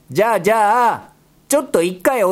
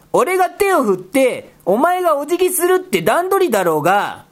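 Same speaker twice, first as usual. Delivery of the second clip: normal talking voice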